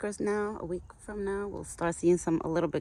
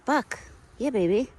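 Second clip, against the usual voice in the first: high, louder sing-song call; calling the pet; 7 semitones higher, 6 dB louder than usual. Real, not acted. Owner calling Buck across the yard, high call. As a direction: call him over sweetly.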